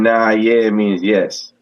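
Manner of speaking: slow explaining. Shown as a demonstration. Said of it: "it's all about how you say".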